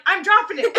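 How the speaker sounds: loud, high cry of panic; panicked cry. Retold or imitated. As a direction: yell that you're letting it drop. Panicked moment at signing.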